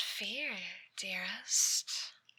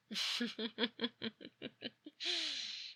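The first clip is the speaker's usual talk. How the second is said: soft creepy laugh; 9 dB quieter than usual. Acted. Stalker amused at her memories.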